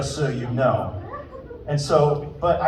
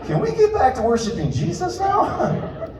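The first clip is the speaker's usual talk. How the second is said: laughing deflection; 11 semitones higher than usual. Retold or imitated.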